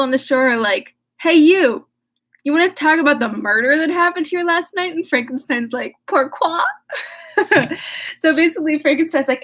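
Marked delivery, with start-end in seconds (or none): 1.2-4.9 high, loud hail across the shore; villagers calling out. Acted. Villagers call out to Frankenstein. 6.1-6.6 high comic French "Pourquoi?", pouty; mock French. Frankenstein answers in French. 6.7-8.1 bright burst of laughter at her own joke; real laugh. Real, not acted.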